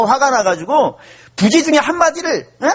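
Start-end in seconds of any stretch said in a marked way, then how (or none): none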